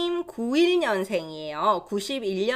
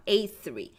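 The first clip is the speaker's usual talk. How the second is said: ordinary talk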